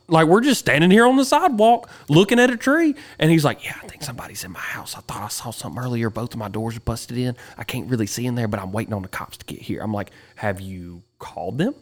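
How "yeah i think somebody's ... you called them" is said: quick hushed whisper. Retold or imitated. Neighbour telling of a break-in, fast whisper. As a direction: rush it out under your breath. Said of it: "he's out there whispering".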